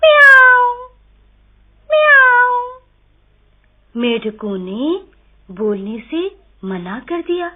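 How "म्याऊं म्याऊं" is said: very high, loud mewing; cat meow. A narrator's reading. Cat meows loudly to the frogs, very high. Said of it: "तब बिल्ली ने कहा".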